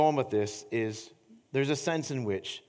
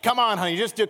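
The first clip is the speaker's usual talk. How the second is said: higher, louder pleading urge; urging wife; 8 semitones higher, 7 dB louder, faster than usual. Retold or imitated. Husband urging his wife to climb on his back, loud and pleading. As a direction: coax her on, voice up and loud.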